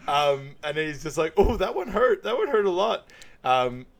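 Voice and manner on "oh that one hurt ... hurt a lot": high, loud mock complaint; put-on hurting voice; imitated pained whine. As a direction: wince through it for laughs. Acting out reaction to waxing pain.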